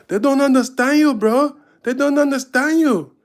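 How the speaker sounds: a fan yelling up to the stage, reassuring; African fan in the crowd. Retold or imitated. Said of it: "an African dude says from the crowd".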